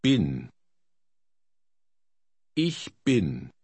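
usual speaking voice

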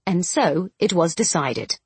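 ordinary delivery, nothing marked

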